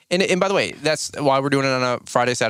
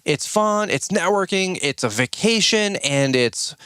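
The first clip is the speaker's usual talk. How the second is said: light imitation of an Alabama accent, pitched higher; Savannah's Alabama accent; 5 semitones higher than usual. Quoting Savannah from a podcast on selling cruises, mild Alabama accent. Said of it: "Alabama accent".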